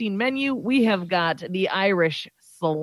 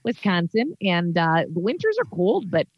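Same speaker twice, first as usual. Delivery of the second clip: chatty Wisconsin character voice; hammed-up upper Midwest local; upper Midwest accent. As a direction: introduce yourself in a folksy Wisconsin accent. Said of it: "a little upper Midwest".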